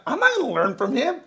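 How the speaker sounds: high mocking scoff; snooty refusal. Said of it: "And they're like".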